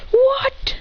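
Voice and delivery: very high, startled exclamation; shocked. Acted. Rivals seeing matching outfits, high shocked outburst. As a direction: squeal it in mock disbelief.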